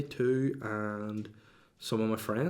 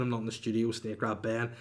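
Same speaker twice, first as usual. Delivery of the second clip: usual speaking voice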